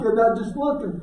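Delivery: usual speaking voice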